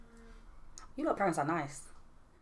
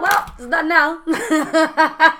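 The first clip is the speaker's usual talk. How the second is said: laughing; 6 semitones higher, 9 dB louder, much slower than usual. Retold or imitated. Mum's resigned reaction, laughing.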